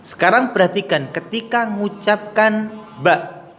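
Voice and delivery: ordinary talk, nothing marked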